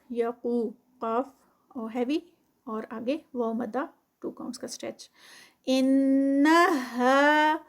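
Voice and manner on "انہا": انہا drawn out with nasal ghunna on نّ; slow chanted recitation; tajweed recitation. Shown as a demonstration. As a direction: hold the ghunna two counts. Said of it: "ان پہ پھر غنہ ہے مشدد".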